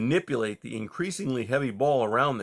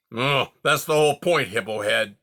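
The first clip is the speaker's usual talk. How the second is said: porcupine snapping back, a touch louder; 5 dB louder than usual. Acted. Porcupine snaps at the young fox, irritated. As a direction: bite back at him, irritated. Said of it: "Era snapped".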